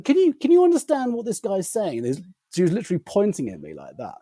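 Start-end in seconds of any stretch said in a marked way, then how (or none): 0.0-2.0 very high, incredulous imitation; baffled assistant. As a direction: appeal to colleagues in amazed bafflement.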